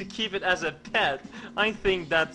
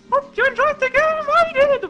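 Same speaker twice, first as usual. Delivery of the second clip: very high, loud cartoon squeak; signing off the game in a chipmunk voice; squeaky sign-off; 12 dB louder than usual. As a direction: chirp it in a tiny silly voice.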